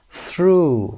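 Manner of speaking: "through" with a long OO, as in boot; OUGH as OO. Shown as a demonstration. Teacher introduces the word to learners, long OO. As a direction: stretch the OO in "through", GH silent. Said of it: "Through صدای او یو جی اچ ما صدای".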